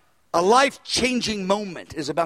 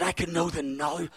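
hushed awe; 6 semitones lower, 10 dB quieter than usual. Retold or imitated. Tearful prayer aside, retold.

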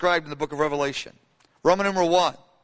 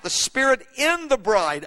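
ordinary talk

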